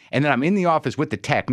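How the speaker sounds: ordinary talk, nothing marked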